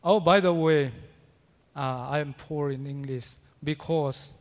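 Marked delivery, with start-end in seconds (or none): none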